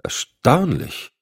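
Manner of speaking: drawn-out, high note of wonder; slow, wondering astonishment; amazed. A narrator's reading. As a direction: marvel slowly at it. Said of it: "wunderte sich der G-Man".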